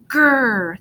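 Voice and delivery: loud growling roar; monster growl yell. A narrator's reading. Snow monster tackling Luke, growling loud. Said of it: "the thing yelled".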